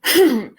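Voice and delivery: sharp real cough. Real, not acted. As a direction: clear the throat with a cough. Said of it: "Excuse me".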